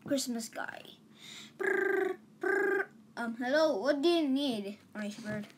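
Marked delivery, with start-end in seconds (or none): 1.6-2.9 high trilled mouth-made ringing; imitated phone ring. Calling the Christmas guy, high trilled ring. I'm just gonna call the.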